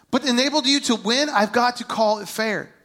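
usual speaking voice

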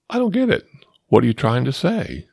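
high-pitched, puzzled friend's catchphrase; Wes. Retold or imitated. Confused friend answering in the car, mock bewilderment. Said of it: "put out one of his favorite sayings".

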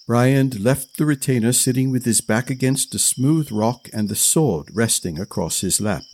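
plain reading voice

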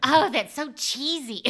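high squeal "Pew-wee", then slow laughing "so cheesy"; mock disgust, laughing. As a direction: she laughs at her own silly client line. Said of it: "the stupidest thing I've ever said".

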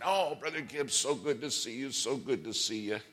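bubbly preacher. Retold or imitated. Preacher greeting Gibbs, lightly mimicked bubbly and high. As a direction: gush over him like an old friend. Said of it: "This guy's all bubbly".

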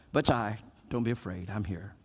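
soft, low, fading to near hush; calm a frightened disciple in the storm; reassuring. Acted. Jesus speaking to frightened disciples at night, soft and reassuring.

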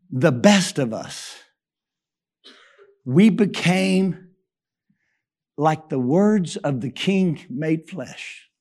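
low, slow and soft reverence; solemn. Retold or imitated. Old soldier telling Miles the deepest lesson, solemn and slow. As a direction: let each word sink in.

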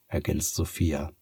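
ordinary delivery

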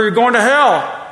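loud, high condemning shout; mock fire-and-brimstone holler; bullhorn yell. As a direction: yell it like a street preacher on a bullhorn. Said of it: "have a bullhorn and yell at her".

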